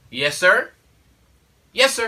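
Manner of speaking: loud cue to a son; prompting yes sir. Retold or imitated. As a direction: call the answer up to him, climbing in pitch. Father prompting son's answer.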